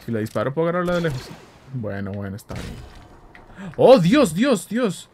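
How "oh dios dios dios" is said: loud, high shocked oh God; sudden alarmed exclamation; startled outcry. Real, not acted. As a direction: caught off guard, blurt it out.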